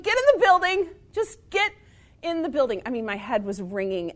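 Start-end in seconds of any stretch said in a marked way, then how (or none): 0.0-2.8 high, loud insistent order; urgent order. Retold or imitated. Principal orders the injured teacher inside, high and loud. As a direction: shoo her inside, urgently. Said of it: "She's like".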